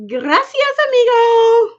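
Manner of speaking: high, loud playful Spanish thanks; cheerful Spanish "gracias, amigo"; Spanish greeting. Pretend. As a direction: toss it off with a Spanish flair. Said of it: "don't all start talking to me in Spanish".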